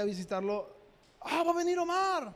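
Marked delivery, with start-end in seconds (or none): 1.2-2.3 put-on much higher, eager voice of the church kids; excited youth. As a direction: squeal it like thrilled teenagers. Church youth excitedly announcing arrival.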